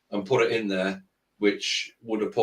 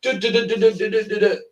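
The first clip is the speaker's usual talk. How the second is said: tattoo machine buzz; 9 semitones higher than usual. Retold or imitated. Mimics tattoo gun on fake skin.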